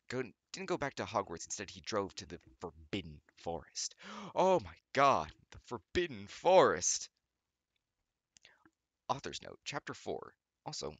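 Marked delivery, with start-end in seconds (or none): 4.3-7.1 loud, slow hammed-up gasp; hammed-up outrage at the plot; mock shock. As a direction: overact the shock for laughs. Mock-dramatic reading.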